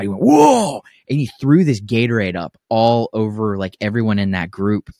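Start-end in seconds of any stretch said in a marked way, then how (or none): 0.2-0.8 high, startled mock "whoa"; freaked-out yelp. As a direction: imitate a guy jumping in alarm. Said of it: "he was, like, freaked out".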